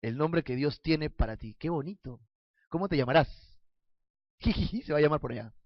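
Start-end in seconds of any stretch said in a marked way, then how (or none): 4.4-4.8 a soft, quick giggle; playful giggle. Acted. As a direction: giggle to the kids. Giggle after naming question.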